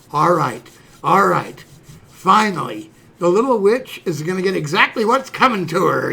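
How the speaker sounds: loud, relishing retold gloat; gloating anticipation. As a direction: savour it like a vengeful kid brother. Boy gloating over sister's coming punishment.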